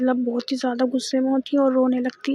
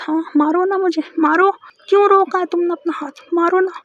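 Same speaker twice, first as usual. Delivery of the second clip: higher, louder, daring her to hit; screaming taunt; 5 semitones higher, 6 dB louder than usual. Retold or imitated. Girlfriend taunting Maysha to hit her, screaming. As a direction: yell it as a frantic provocation. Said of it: "ज़ोर-ज़ोर से चिल्लाने लगती है".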